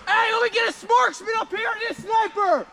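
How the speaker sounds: high, loud urgent shouting; excited shouting. Real, not acted. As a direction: yell the alarm to your squad. Spotting a huge enemy force.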